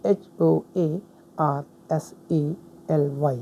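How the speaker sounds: teacher spelling hoarsely letter by letter for students; slow spelling out. Shown as a demonstration. Teacher spelling it out slowly for the class. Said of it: "cry होशली".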